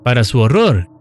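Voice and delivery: ordinary delivery, nothing marked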